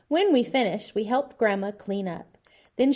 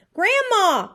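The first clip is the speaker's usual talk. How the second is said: high, loud cry for help; little boy calls out in alarm; 12 semitones higher, 9 dB louder than usual. A narrator's reading. Little boy crying out to Grandma for rescue. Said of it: "Fudge cried".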